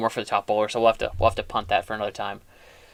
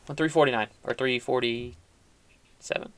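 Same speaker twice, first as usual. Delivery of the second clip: normal talking voice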